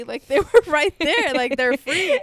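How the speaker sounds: put-on naive kid insisting. Little girl telling mom the stolen cards were free. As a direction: protest innocently, half laughing. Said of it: "I was like".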